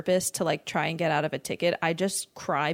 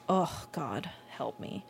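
mock despair; 8 dB quieter, slower than usual. Cringing at old photos.

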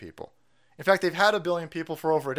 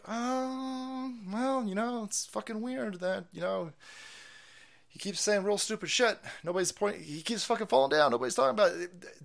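higher, softer, prissy mock liberal; 7 semitones higher, 4 dB quieter than usual. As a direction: imitate a hesitant leftist musing. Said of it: "people on the left-hand side who are like".

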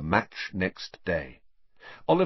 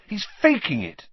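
high, angry accusation; indignant and louder; furious; 12 semitones higher, 5 dB louder than usual. A narrator's reading. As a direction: accuse him hotly, pitch jumping up. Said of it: "said Harry furiously".

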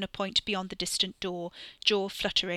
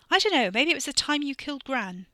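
sharp, louder and higher retort; snapped; 6 semitones higher, 6 dB louder than usual. A narrator's reading. Sister snaps at her complaining brother, sharp and loud. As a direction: bite back at him hard. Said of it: "Catherine snapped".

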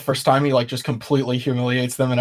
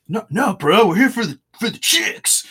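higher, sheepish bro excuse; 6 semitones higher than usual. Pretend. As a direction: bro squeaking up his excuse. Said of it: "And they're like".

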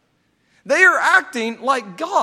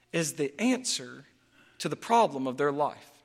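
ordinary talk

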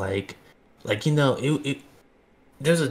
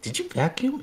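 high innocent little voice, caught out; guilty kid asking mom, pitched way up; mock high timid question. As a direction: squeak it out nervously, much higher.

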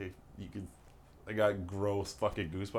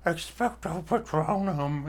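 big, slow, high spell-casting cry; hammed-up Harry Potter spell; wizard casting a spell; 10 semitones higher, 8 dB louder, slower than usual. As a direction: bellow the incantation like a wizard. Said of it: "when Homeboy's like".

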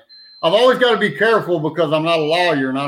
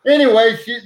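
ordinary talk

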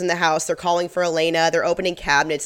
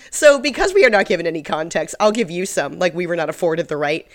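ordinary talk

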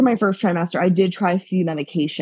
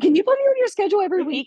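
eager plea; 12 semitones higher, much faster than usual. Retold or imitated. Eager request to favourite nurse.

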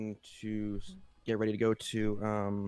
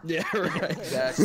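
laughing; 6 semitones higher, 7 dB louder than usual. Real, not acted. Laughs agreeing.

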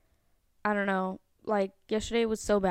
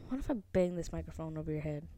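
quick soft mock threat; 8 semitones lower, 9 dB quieter, much faster than usual. Real, not acted.

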